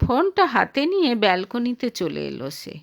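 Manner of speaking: ordinary delivery, nothing marked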